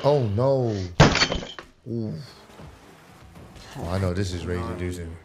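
normal talking voice

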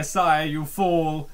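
ordinary talk, nothing marked

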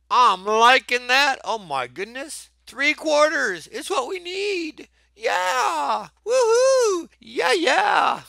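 high joyful squeals of delight; excited squeal. Real, not acted. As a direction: squeal with real glee at the right answer. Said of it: "my voice really getting high there".

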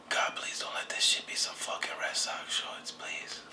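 breathy whispered plea to God; whispered prayer. Real, not acted. Begging God before opening the box, whispered. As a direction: pray under your breath, begging.

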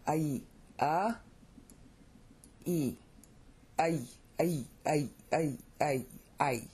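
"a" and "e" apart, then blended into repeated "ay"; diphthong drill. Shown as a demonstration. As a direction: glide a into e as short separate ay syllables. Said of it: "turns into a long A".

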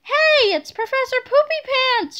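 put-on high, loud boyish shout; small boy shouting. A narrator's reading. Small boy spotting Professor Poopy Pants, shouting high. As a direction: pitch it way up and call out. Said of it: "shouted a small boy".

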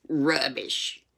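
British-sounding "Rubbish"; slightly British aunt, dismissive; too British. Acted. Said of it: "sounded too British".

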